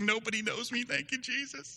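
high, light relieved voice; relieved mock prayer. Pastor joking about his relief at a new city, high mock prayer. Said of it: "I was like".

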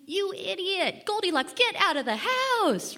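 playful exasperation, high and emphatic; mock exasperated scolding. Scolding a storybook character.

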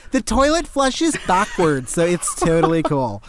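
high, lightly put-on classmate; a hint of a gullible student; mocking classmate voice. A hint of a classmate teasing the fake Australian. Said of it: "And they're like".

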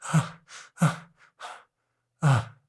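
soft whispered sob breaths; voiceless catching sobs after crying; imitated sobbing breaths. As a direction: gasp out quiet crying breaths. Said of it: "empiezas a escuchar el".

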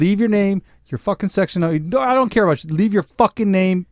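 angry. Acted. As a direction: bark the rules out. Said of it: "it got so angry so".

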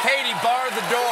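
loud rallying shout. Pretend. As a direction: yell it out with gusto. Shouting the Irish expression.

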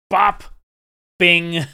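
two short, loud popped-out noises; punchy sound effects. Retold or imitated. Podcaster showing how easily Vader did it, punchy sound effects. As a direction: toss out "bop, bing" like effortless blows. Mimics Vader's effortless moves.

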